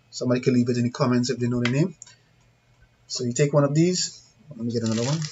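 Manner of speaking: ordinary delivery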